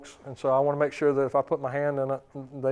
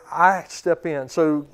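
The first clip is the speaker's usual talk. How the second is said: ordinary talk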